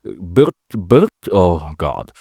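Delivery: ordinary delivery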